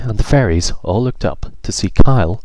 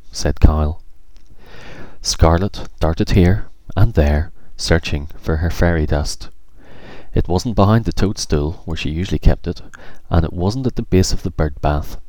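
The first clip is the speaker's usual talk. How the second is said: ordinary delivery, nothing marked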